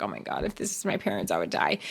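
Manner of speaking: hushed, low aside to oneself; retold emotional moment. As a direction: recall being moved, very softly. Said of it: "I was getting emotional".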